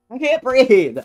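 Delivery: sudden loud high cry of I can't breathe; gasped loud exclamation, voice changed; breathless cry. Real, not acted. Gasping exclaim while gaming.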